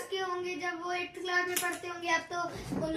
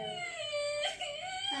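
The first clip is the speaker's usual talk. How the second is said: slow, tearful question; choking on tears, asking why; real crying; 11 semitones higher, 7 dB quieter than usual. Real, not acted. Boy starts crying over friends.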